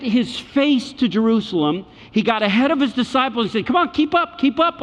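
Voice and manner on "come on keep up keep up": higher-pitched call back to stragglers; urgent calling. Acted. Jesus ahead of his disciples on the road, urgent calling. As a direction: hurry them along in a raised voice.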